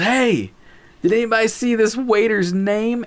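high-pitched retold shout to the table; calling out. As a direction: imitate someone hollering down a long table.

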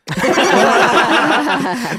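bursting out laughing, high; a big loud laugh. Real, not acted. Trap roll goes comically wrong.

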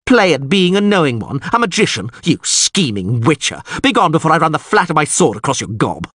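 high, loud indignant tirade; furious yell. A narrator's reading. Lord berates the scheming witcher and orders him gone, furious. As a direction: rant at them with haughty outrage. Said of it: "Ostrit yelled furiously".

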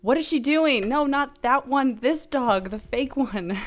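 high, baffled voice; confused friend. Retold or imitated. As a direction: ask it in bewildered disbelief. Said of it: "And Brian's like".

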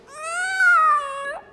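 high, loud, sustained mock scream. Storyteller acts out a dragon grazed by a blade, mock scream. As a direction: imitate a high-pitched cry of pain.

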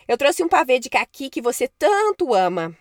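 loud sweet-talking witch call. Acted. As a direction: lure him out with a loud call. Witch calls boy in stable.

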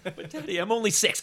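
put-on high, crying child's protest, shouted; a little kid wailing at Daddy; whiny child. A six-year-old wails a protest at Daddy, high and teary. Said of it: "child voiced in a bit".

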